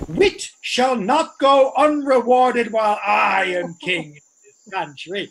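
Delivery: ordinary delivery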